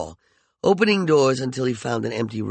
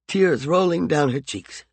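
plain reading voice

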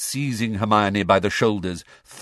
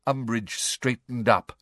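ordinary delivery

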